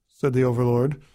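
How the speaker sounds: ordinary delivery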